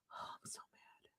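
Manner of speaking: breathy exhausted whisper of frustration; whispered anger. Real, not acted.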